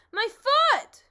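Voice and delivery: very loud, high yelp; pained shout. Acted. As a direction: cry out as the foot is hit. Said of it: "shouted the bee".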